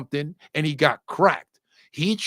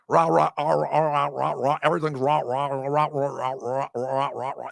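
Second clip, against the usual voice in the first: rumbling motorcycle take on Australian speech; mock Australian accent. Man teasing Australians about how they talk, mock Australian accent. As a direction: parody Australian as run-together growly "rah rah". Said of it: "you sound like a bunch of motorcycles".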